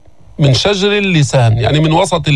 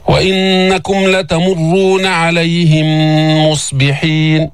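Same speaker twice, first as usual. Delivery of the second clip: slow, sustained tajweed recitation; Quran recitation; slower than usual. Shown as a demonstration. Teacher recites the verse for learners, slow recitation. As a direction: hold the hums and long vowels.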